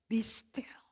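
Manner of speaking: soft whispered be still. Acted. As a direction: hush them, barely breathing it. God's voice quoted whispered.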